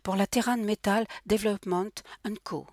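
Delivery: high, careful schoolgirl recital; studious tone, each name carefully placed; diligent schoolgirl. A narrator's reading. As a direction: recite it dutifully like a pupil. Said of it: "d'un ton appliqué comme une écolière".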